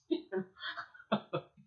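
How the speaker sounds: breathy, mostly unvoiced chuckle; amused laugh. Real, not acted. Teacher amused by the shepherd's words, light laugh. Laughs after retelling the quote.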